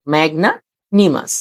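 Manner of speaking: slow "ম্যাগনানিমাস" as magna, nimas; "ম্যাগনানিমাস" slowly, parted after magna; broken in two. Shown as a demonstration. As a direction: say "ম্যাগনানিমাস" in two chunks with a short pause. Teacher repeats word in parts.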